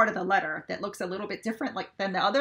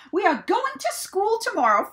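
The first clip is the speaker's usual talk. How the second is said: bright announcing farmer, a bit louder; eager Farmer Brown; 11 semitones higher, 6 dB louder than usual. A narrator's reading. Farmer announcing school trip to his cows, eager. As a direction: call the news out to the cows. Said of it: "Farmer Brown told the chickens".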